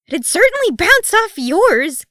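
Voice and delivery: very high, loud, drawn-out outburst; almost shriek it in exasperation; shrill exasperated yelp; near shriek. A narrator's reading. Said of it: "Keeley nearly shrieked".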